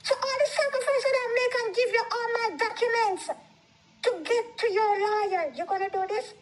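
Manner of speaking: very high, incredulous protest; hurt and indignant in a retold quarrel; indignant disbelief. Retold quarrel with husband, her shocked reply.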